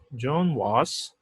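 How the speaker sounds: very slow "John was"; slow pointing out. Shown as a demonstration. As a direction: drag it out to show the structure. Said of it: "é igualzinho aqui". (leitura lenta e apontada)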